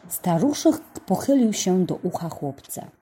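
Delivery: usual speaking voice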